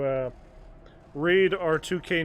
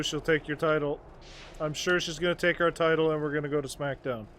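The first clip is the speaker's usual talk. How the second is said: usual speaking voice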